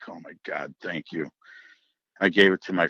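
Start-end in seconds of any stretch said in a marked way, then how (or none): 0.1-1.3 soft, low, breathless oh my God; relieved thanks. Retold or imitated. Landowner lets hunter keep deer, soft relieved thanks. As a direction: melt with relief as he's spared. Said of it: "I'm like".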